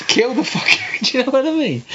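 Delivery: high, giggling through the words; laughing. Real, not acted. Laughing while finishing the thought.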